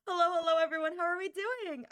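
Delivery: bright loud hello, rising high; excited greeting. Acted. Streamer greeting chat, loud high excited hello. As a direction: call out hello to the whole chat.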